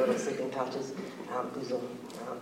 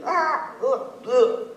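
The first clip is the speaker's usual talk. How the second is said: pained falling cries; 10 semitones higher, 4 dB louder, much slower than usual. Acted. Character falls through trees.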